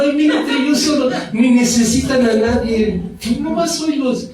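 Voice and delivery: high, quick catty neighbour; high mocking neighbour voice; catty gossip.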